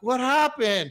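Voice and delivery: high, loud mock-innocent question; acting not scared. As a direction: blurt it out high, pretending you weren't scared. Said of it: "Trying to act like I wasn't scared".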